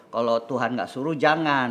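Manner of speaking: ordinary delivery, nothing marked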